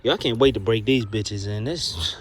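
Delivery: ordinary talk, nothing marked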